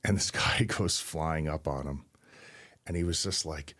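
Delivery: normal talking voice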